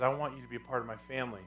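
soft, low, quick aside voicing God; God's gentle invitation. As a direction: drop right down, an intimate invitation. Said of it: "And he says".

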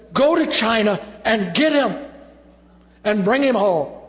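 loud, high eager demand; child's loud plea. Retold or imitated. Little Micah urging his parents to fetch Drew, loud and high. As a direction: call it out like a boy begging.

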